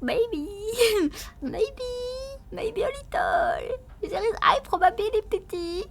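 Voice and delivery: high giggly maybe; laughing admission. Real, not acted. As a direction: concede it through giggles. Admitting accent through laughter.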